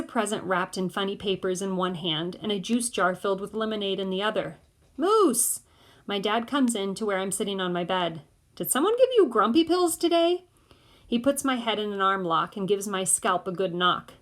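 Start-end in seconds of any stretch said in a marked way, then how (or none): 5.0-5.6 high, loud call of a name. A narrator's reading. Piper calling his name. 8.5-10.4 high, loud playful teasing; teasing dad. A narrator's reading. Dad teasing his son.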